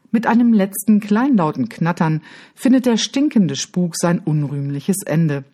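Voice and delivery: usual speaking voice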